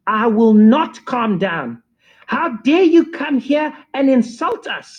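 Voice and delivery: mock the furious teacher; teacher refusing to calm down, mock outrage; indignant teacher. Teacher argues back.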